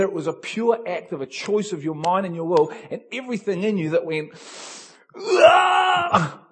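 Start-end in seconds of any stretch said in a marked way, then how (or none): 5.2-6.4 loud, high shout of breakthrough; sudden cry of release. Acted. Peter cries out stepping onto water.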